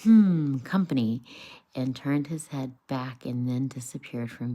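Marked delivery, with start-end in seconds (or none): 0.0-1.2 grunt. Acted. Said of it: "then grunted".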